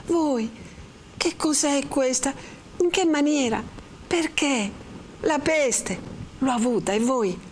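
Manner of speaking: high, halting questions; trembling amazement. A narrator's reading. Lucia questions Renzo's arrival, high and shaken. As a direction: ask in astonished fragments, pitched high. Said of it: "replicò ancora più tremante Lucia".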